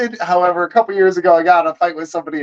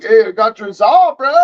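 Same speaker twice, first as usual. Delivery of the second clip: cheery Mister Happy; 6 semitones higher, 3 dB louder than usual. Retold or imitated. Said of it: "I'm trying to be Mister Happy".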